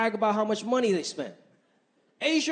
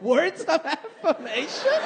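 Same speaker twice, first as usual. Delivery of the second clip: baffled; 5 semitones higher, slower than usual. Retold or imitated. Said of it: "I'm like".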